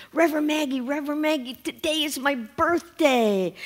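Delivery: put-on Johnny running up to the minister, eager child voice; excited little boy. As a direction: call out like a small boy bursting with news. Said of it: "He says".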